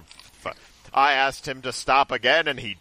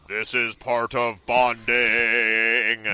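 slow, deep-set creepy calm quote; a hint of a creepy calm dragon; 3 semitones lower, much slower than usual. Quoting the dragon.

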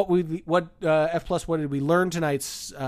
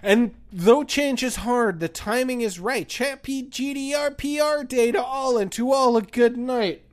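poem reading; 5 semitones higher than usual. Acted. Reads a poem ending aloud.

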